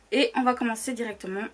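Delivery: normal talking voice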